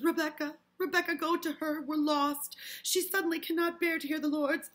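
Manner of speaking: high, loud, panicked begging; desperate plea. Acted. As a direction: plead loudly as if all is lost. Parris pleads desperately, acted.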